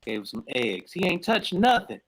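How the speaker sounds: ordinary talk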